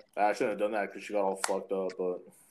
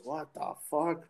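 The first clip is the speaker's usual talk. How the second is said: imitating grandma; 4 semitones higher, 4 dB quieter, slower than usual. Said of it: "she goes".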